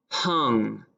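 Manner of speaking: ordinary talk, nothing marked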